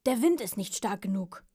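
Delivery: loud, cross protest; grumpy pug, forcefully blaming the wind; annoyed. Acted. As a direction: complain crossly at full voice. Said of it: "sagte der Mops ärgerlich".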